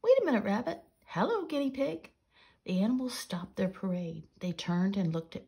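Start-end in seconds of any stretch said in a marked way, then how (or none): 0.0-2.1 high, lively calling out; hailing. A narrator's reading. Peter Painter greets the passing animals, hailing them. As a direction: call to each one, pitched well up. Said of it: "hailed Peter Painter".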